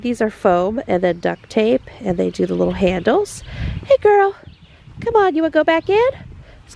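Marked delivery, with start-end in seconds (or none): 3.8-6.1 high, louder coaxing call; high and fast, louder; calling the cow. Real, not acted. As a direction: coax the cow toward the barn.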